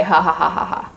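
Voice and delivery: short acted villain cackle; staccato ha-ha, falling in pitch; crazed laugh. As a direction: tack on a mad laugh. Crazed character laughs after threat.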